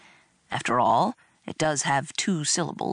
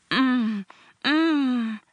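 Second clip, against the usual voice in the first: long, higher-pitched "mmm" of delight; faked ecstasy; 7 semitones higher than usual. A narrator's reading. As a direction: hum in put-on bliss over food. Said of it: "I faked ecstasy at the taste of food".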